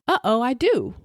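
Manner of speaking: high, chirpy mock alarm; playful upbeat taunt; strangely upbeat. A narrator's reading. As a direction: sing out brightly, oddly cheerful. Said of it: "The infection so strangely upbeat".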